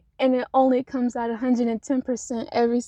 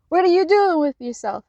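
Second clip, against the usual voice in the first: higher, louder voice of a critic; raised-voice imitation of someone questioning you; mock challenge; 6 semitones higher, 6 dB louder than usual. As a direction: call them out like a disapproving onlooker. Acted challenge from a critical onlooker.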